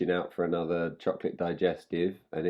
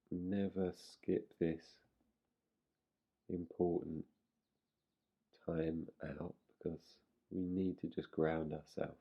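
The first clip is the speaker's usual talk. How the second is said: guided meditation; 6 dB quieter than usual. Real, not acted. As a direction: softly settle them in, leaving space between phrases.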